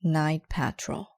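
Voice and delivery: ordinary delivery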